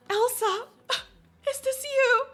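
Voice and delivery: high-pitched, gasping mom on the phone; distraught mother. Pretend. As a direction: call to your daughter through panicky gasps. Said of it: "her mom is like".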